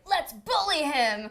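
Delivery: drawn-out, hammed-up battle cry; gang rallying cry. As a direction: imitate a gang shouting together. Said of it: "they're like".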